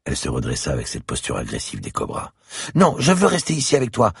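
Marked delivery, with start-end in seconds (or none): 2.7-4.1 put-on high, loud begging child; cling to him, don't let him go; pleading little girl. A narrator's reading. Little girl begs the policeman not to leave, high and loud.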